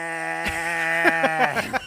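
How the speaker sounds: loud sloppy mock drunken guffaw; drunk laugh. Mimicking his drunk friend at the comedy show, loud sloppy guffaw. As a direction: imitate a wasted friend's laugh. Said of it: "doing that drunk laugh".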